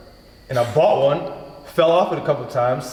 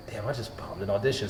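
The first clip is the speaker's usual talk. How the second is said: dejected mutter; 7 semitones lower, 11 dB quieter than usual. Retold or imitated. He realises he bombed the audition.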